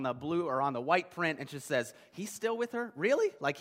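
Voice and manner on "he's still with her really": high, rising disbelief; mock incredulous. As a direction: act scandalized by the tabloid headline. Incredulous tabloid headline, repeated.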